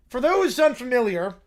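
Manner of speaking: normal talking voice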